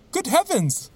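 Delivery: a high, sudden cry of shock; startled cry. Acted. Watson, startled by the disguised priest, cries out in shock. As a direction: jolt out of your seat in alarm. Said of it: "I cried".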